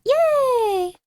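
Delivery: high bright cheer; cheerful yay. Real, not acted. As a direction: squeal yay happily.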